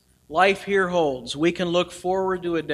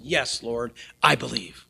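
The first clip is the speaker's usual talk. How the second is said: quiet; 7 semitones lower, 6 dB quieter than usual. Retold or imitated. Martha's quiet answer.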